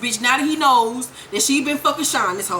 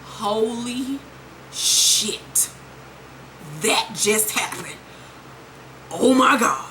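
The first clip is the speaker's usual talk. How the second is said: stunned disbelief; 3 semitones lower, 4 dB quieter, slower than usual. Real, not acted. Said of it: "I was sitting there like".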